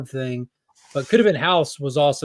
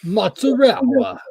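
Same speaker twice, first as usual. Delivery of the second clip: mock Italian; 9 semitones higher, 5 dB louder, slower than usual. Mocking Italian pronunciation.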